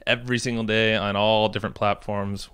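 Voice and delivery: ordinary talk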